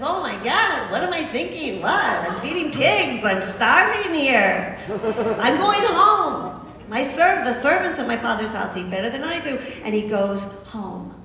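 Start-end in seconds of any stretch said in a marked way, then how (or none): 0.0-9.6 frantic realization. Retold or imitated. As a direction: wail it like a desperate young man hitting bottom. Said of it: "he probably said it like this".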